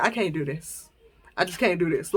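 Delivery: soft, drained mock plea of giving up; retold weak giving up.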